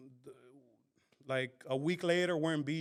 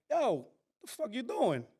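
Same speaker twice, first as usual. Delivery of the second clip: put-on girlfriend's voice; 7 semitones higher than usual. Girlfriend voiced asking what he's doing.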